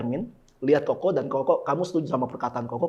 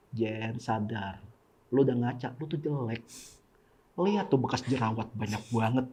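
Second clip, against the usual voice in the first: devil's soft taunt; 5 semitones lower, 7 dB quieter than usual. Pretend. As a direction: lure her quietly, like a voice at her ear. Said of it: "iblis mau nggak mau deket dikit". (iblis berbisik pelan di dekat telinga)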